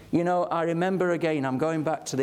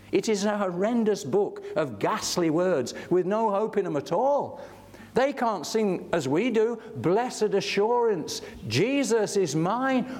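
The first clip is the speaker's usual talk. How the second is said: higher, rising preacher's fervour; fervent preaching; 4 semitones higher than usual. Acted. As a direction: proclaim it to the whole hall with growing conviction. Preaching climax to congregation.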